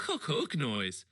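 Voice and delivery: plain reading voice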